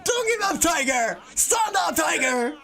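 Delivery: high rallying yell; cheering shout. Acted. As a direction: cheer her on at full voice. Students cheering the fallen teacher.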